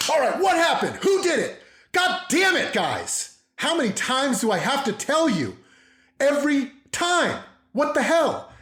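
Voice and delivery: high, strained mock-furious scolding; dad losing it. Dad after someone gets hurt, acted angry outburst. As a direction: blow up at the kids in exasperation. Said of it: "when dad loses it".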